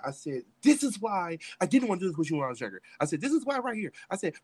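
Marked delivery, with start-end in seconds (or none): 0.6-2.8 louder, higher retold outburst; indignant protest. Retold argument at gunpoint. 3.2-3.9 high-pitched retold protest; indignant protest. Retold argument at gunpoint.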